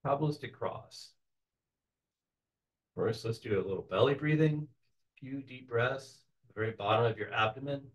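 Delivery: soft, unhurried meditation voice with long pauses; softly led visualization, phrase by phrase; guided meditation. Real, not acted. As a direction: gently guide the group into a relaxed trance.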